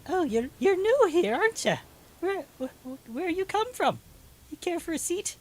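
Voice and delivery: high, loud halfling voice; halfling woman. Acted. Halfling greets newcomers in her doorway, high loud voice. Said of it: "she says".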